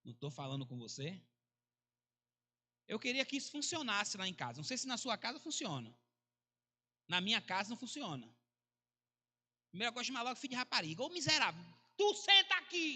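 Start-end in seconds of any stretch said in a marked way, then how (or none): 0.0-1.1 very low, soft reprimand; hushed scolding. Retold or imitated. Preacher imitates pastor correcting his son, speaking very softly and low. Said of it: "fale baixinho com ele". 11.0-12.9 higher, rising scolding yell; mock angry parent. Preacher acts out a parent ordering the child to sit, mock-angry yell.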